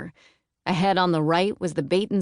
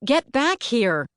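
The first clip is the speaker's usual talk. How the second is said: put-on louder, higher, slow call after a child; 7 semitones higher, 3 dB louder than usual. A narrator's reading. Aunt calling after the runaway boy, loud. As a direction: call him back across the lot. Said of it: "she called".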